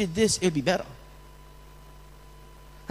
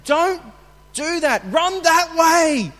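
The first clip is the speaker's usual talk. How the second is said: higher, louder sideline call, slowed on the last words; coach's rebuke; 7 semitones higher, 4 dB louder, slower than usual. Retold or imitated. Coach rebuking kids during the game, raised sideline call. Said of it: "It's a rebuke".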